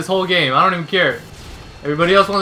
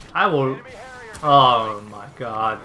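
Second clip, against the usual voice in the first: ordinary delivery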